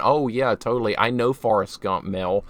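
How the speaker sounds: normal talking voice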